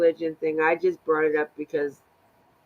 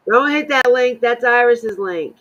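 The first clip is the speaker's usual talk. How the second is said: loud sharp warning, raised pitch; urgent warning; 6 semitones higher, 8 dB louder than usual. Real, not acted. Friend warning not to click the link, loud and urgent. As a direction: shout a quick warning to a friend.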